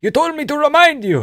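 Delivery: put-on high loud accented insistence; eager high Georgian voice; Merab impression. Merab voiced random advice.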